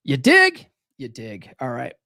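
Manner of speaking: ordinary delivery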